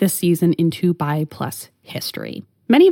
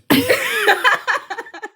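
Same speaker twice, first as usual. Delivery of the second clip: laughing. Real, not acted. Laughing at own joke.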